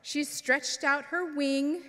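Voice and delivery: ordinary delivery, nothing marked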